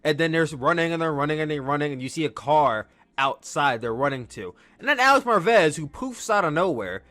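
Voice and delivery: normal talking voice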